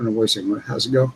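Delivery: usual speaking voice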